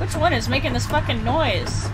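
loud irritated outburst; annoyed. Real, not acted. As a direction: snap at the noise in frustration. Annoyed by alarm noise.

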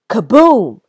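loud, high burst like thunder; booming kaboom. A narrator's reading. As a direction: boom it out like a thunderclap. Thunder sound word.

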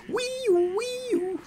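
siren-like wail. Retold or imitated. Siren pun on console name.

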